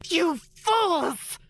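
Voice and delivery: high furious alien shriek. Acted. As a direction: screech it out in rage. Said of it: "the Skrong leader shrieked".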